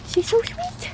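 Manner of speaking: high, soft coo at the dog. Real, not acted. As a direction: melt over her sweetness. Cooing over her dog.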